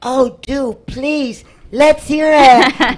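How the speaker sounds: high, loud, delighted invitation; eager and excited. Real, not acted. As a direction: cheer her on to read it. Host eagerly invites the reading.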